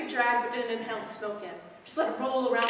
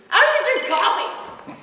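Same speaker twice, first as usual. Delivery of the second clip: indignant outburst; 12 semitones higher, 11 dB louder than usual. Acted.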